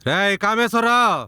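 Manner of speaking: high, drawn-out summons; calling out. Acted. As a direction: call the name slowly from a distance. Visitor calls out for the tenant. (గట్టిగా సాగదీసి పిలుస్తూ)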